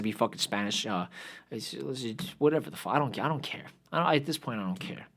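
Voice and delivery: soft, listless muttering; low-energy mood. Real, not acted. Podcaster giving up on the rant, soft and listless. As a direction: let the energy drain out, barely bothering. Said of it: "[name]'s got no energy".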